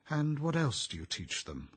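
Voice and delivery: politely interested question, lifted in pitch. A narrator's reading. Theodora questioning a guest about his school, polite. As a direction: ask with courteous interest. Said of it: "Theodora asked politely".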